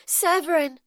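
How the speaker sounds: high, loud panting cry; panted name. A narrator's reading. Rayna panting her lover's name, high and loud. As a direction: gasp his name out, breathless. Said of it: "She panted his name over and over again".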